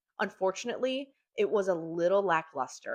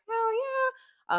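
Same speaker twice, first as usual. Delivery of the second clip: much higher, thrilled hell yeah; eager yes; 4 dB louder than usual. Retold or imitated. Families jumping at Geraldine's cash offer, much higher and thrilled. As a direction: jump at the offer. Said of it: "the families are like".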